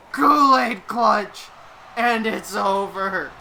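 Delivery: slow, weighty announcement of the winner; slow dramatic call. Acted. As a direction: let each word land. Match ends, dramatic verdict.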